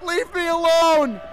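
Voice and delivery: mock shout. Repeating line.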